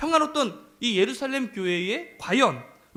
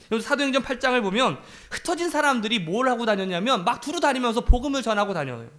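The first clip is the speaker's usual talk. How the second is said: louder, higher rising sermon drive; preaching build; 6 semitones higher, 3 dB louder than usual. Acted. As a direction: drive the point home from the pulpit. Preaching climax.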